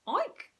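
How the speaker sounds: very high squeaky oink; a small chubby frog's oink. Acted. Frog oinks right in the man's face. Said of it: "oinked the frog".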